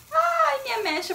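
very high, loud sudden yelp; dismayed yelp. Real, not acted. Showing viewers her blondest front streak, a dismayed high yelp. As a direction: squeal at the sight of the blond streak.

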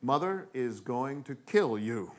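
acted-out brother pronouncing doom matter-of-factly; measured, word-by-word pronouncement; stating the obvious. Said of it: "to state the obvious".

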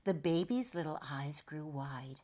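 ordinary delivery

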